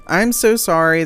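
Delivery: a loud, emphatic I'm so sorry; loud apology. Real, not acted. To viewers after the video cut, a loud sorry. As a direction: throw the apology out big, leaning on so.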